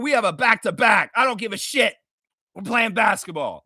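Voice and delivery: ordinary talk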